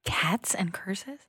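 slow whispered question; whispering. Real, not acted. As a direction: breathe it under the breath, unsure. Whispered echo of quiz option.

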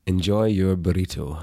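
measured Scottish delivery; Scottish accent. Shown as a demonstration. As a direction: say it Scottishly, as a farewell to listeners. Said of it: "Would you please tell people Scottishly".

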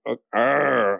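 half-hearted growl; reluctant mock growl. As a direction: growl it like you hate doing it. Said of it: "And I'm like".

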